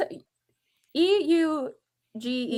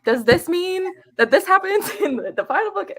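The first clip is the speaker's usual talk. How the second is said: mock alarmed question; 7 semitones higher, 4 dB louder than usual. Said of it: "because I was like".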